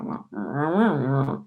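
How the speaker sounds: low mock dog grumbling; dog grumble imitation. Retelling how the dog complains when scolded, low grumbling wahs. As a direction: grumble wah like a sulky dog. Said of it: "he has a southern grumble".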